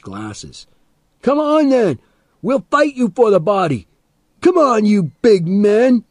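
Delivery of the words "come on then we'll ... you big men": high, challenging yell; loud, high-pitched challenge to fight; sudden shout. A narrator's reading. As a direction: burst into a shouted dare. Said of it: "Teddy suddenly shouted".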